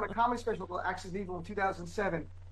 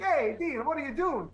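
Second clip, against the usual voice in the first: put-on higher-pitched Italian-accented greeting; 5 semitones higher than usual. Greeting Dean at a pizza bar, higher Italian accent.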